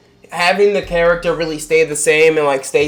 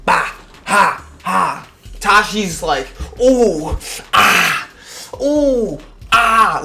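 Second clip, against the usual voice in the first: mock excited moans; 4 semitones higher, much slower than usual. Tashi watching the match, hammed-up excited cries. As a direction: moan it out like she's thrilled by the match. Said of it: "Tashi's getting off on this".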